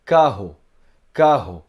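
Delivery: carro said with a guttural R like Hebrew resh; carro, back-of-throat rolled R; teacher demonstrating carro with resh. Shown as a demonstration. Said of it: "vou falar agora a palavra carro com ré".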